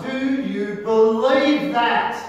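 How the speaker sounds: loud, high, slow preaching challenge; fiery preacher voice; preaching at full force. Real, not acted. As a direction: thunder the question at them. Preacher presses the congregation.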